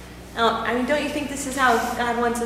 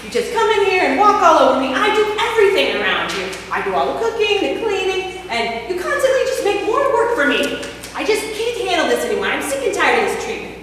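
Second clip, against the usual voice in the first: angry scolding; 6 semitones higher, 5 dB louder than usual. Acted. Wife scolds husband over mud.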